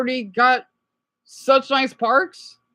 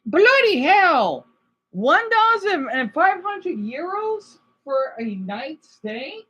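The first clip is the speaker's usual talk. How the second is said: drawn-out, higher-pitched exclamation; incredulous; 4 semitones higher, much slower than usual. Real, not acted. Reacting to a British man's hotel price, incredulous. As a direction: stretch it out in disbelief at the price.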